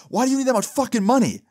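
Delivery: high, fast mock yell; imitated mom yelling. Mom demands answers from her son, imitated high yell. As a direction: put on an indignant mother's high-pitched yell. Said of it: "the girl starts yelling".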